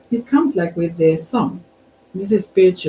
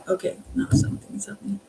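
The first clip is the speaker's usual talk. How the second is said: whisper; 13 semitones higher, 6 dB quieter, faster than usual. Real, not acted. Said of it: "someone is here".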